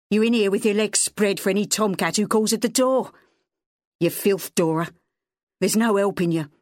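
a lightly voiced angry father yelling at the house; drunken rant. A narrator's reading. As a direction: rail at her as the angry husband in the yard. Wally keeps abusing Dora.

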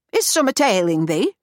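put-on Yorkshire accent, brusque and loud; blunt Yorkshire dialect question. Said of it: "The words were brusque".

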